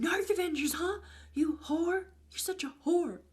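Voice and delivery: high, mocking angry taunt; imitating an angry yell. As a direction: spit the insult at her like an angry ex. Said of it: "he's yelling, calling me a loser".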